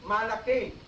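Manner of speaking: Malakain clearly in Arabic, mah-lah-kayn; Arabic word. Shown as a demonstration. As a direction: repeat Malakain for students. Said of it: "Can you remember this?".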